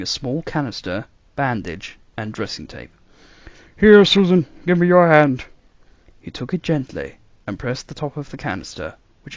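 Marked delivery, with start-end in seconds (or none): 3.8-5.4 put-on higher, puzzled elderly doctor; musing old man, baffled; puzzled grandfather voice. A narrator's reading. Doctor tending.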